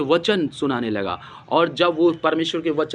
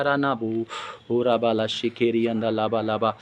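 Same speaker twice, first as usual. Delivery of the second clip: low, even, rolling chant of syllables; praying in tongues; 6 semitones lower, 4 dB quieter than usual. Real, not acted. Preacher praying in tongues mid-sermon, low and monotone. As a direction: pray on in a lower monotone.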